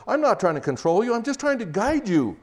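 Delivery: a hint of a self-justifying husband; defensive husband. Husband insisting to his wife he only guides her, protesting. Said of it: "don't say to her something like this".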